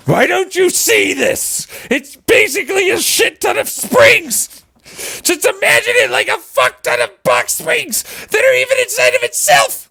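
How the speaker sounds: high shouted frustration; exasperated yell. Retold or imitated. Explaining springs to doubters, high exasperated yelling. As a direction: shout it like you can't believe they don't see it. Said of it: "I'm like".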